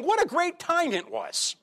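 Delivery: high, dreamy mock longing for Egypt; mock nostalgia. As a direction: gush about the old days, mockingly. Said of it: "now you're saying".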